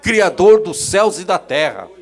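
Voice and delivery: loud proclaiming preacher; loud fervent preaching; preaching at full force. Acted. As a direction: thunder it out to the congregation.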